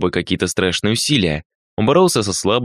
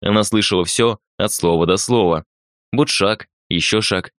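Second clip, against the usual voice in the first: ordinary delivery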